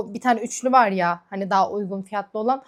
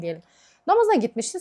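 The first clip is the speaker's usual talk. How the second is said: ordinary talk, nothing marked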